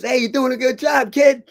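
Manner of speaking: high, loud call across the corner; hollering referee. Retold or imitated. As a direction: shout encouragement as the referee. Acts out referee calling to corner.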